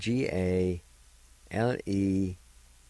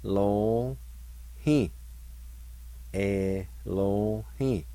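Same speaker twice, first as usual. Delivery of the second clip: Elohim drawn out very slowly, ending in a fall; slow demo; much slower than usual. Shown as a demonstration. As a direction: model Elohim slowly for learners. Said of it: "is pronounced like this".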